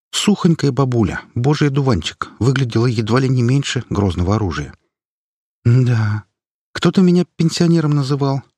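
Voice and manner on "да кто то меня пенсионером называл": lightly voiced feisty old granny, a bit higher; a hint of a spry old woman teasing; granny voice, lightly done.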